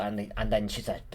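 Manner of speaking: ordinary delivery, nothing marked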